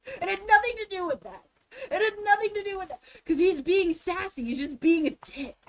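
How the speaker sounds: high, loud and indignant; exasperated insistence. Real, not acted. Ranting that the clerk is just sassy, exasperated. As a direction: insist angrily, leaning on each word.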